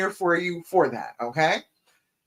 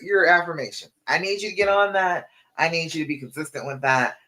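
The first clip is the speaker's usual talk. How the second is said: ordinary talk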